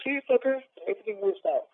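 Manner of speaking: high-pitched teasing vindication; triumphant, high-voiced I-told-you-so; retold told-you-so. Acted told-you-so to brother.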